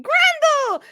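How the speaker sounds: high, mock holler of the name; imitated hailing call. Player echoing the call Grendel hears, high holler. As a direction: imitate a parent calling out high. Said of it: "And upon hearing".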